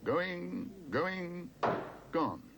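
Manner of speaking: high calls, falling on gone; auctioneer's countdown. A narrator's reading. Auctioneer closing the sale on Paddington. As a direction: bring the hammer down. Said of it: "called the auctioneer".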